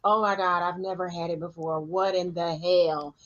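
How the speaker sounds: ordinary talk, nothing marked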